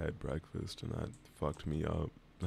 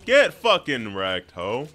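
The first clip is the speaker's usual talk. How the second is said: triumphant shout; 3 semitones higher, 14 dB louder, slower than usual. Real, not acted. Clutch kill celebration.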